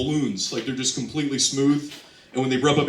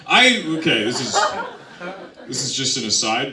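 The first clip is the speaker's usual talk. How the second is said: normal talking voice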